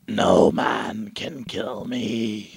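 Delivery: very slow, low, menacing villain; evil voice. Retold or imitated. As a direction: drag each word out like a dark lord. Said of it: "I just did a typical evil voice".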